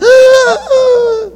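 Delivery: sharp, high choking gasp; gasp for air. Retold or imitated. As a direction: gasp like you were punched breathless. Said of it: "eu não consegui respirar".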